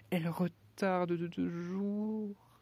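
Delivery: high, very slow lament; sighing Hatter. Acted. The Hatter mourning his watch, slow sighing lament. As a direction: sigh it out sadly. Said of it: "soupira le chapelier".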